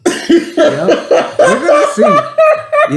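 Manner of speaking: very high, loud and slow through laughter; can't stop giggling; laughing squeal. Real, not acted. Teasing a friend about the joke, squealing with laughter.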